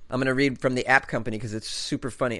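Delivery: ordinary delivery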